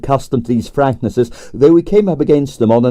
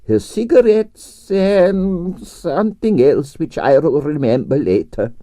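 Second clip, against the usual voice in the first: lightly voiced absent-minded old husband, higher; mild mumble; 5 semitones higher, slower than usual. A narrator's reading. Old husband mumbling as he ambles off. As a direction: murmur it to himself, a little higher. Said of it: "he mumbled".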